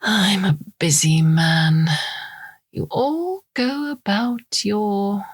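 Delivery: slow, hushed, superior villain; menacing villain. Acted. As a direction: drawl each word like you own her. Storm looms over drugged Eve.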